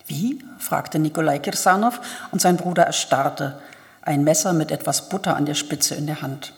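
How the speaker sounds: calm, even audiobook reading with lightly voiced characters; measured literary narrator reading Turgenev; story narration. A narrator's reading. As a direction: read the novel aloud, steady and clear.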